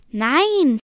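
loud, high cry of no; emphatic objection. A narrator's reading. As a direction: call out to stop them short. Merman stops twins leaving at night. (lauter, energischer Einspruch)

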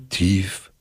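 ordinary delivery

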